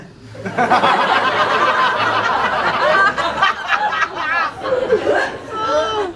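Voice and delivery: long high laughter. Real, not acted. Laughing hard at his own taxi joke.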